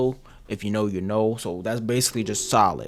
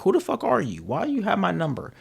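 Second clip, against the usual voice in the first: higher, mock-irritated what-the-hell reply; annoyed stranger; 7 semitones higher, 4 dB quieter than usual. Stranger answering an unknown caller, mock irritated. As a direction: play the confused, irritated person picking up the phone. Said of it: "it'd be like".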